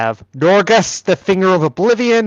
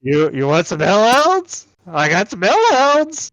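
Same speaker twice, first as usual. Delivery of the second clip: lightly voiced hellhound seller; 5 semitones higher than usual. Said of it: "I'm not gonna do a Mexican accent, but he will be like".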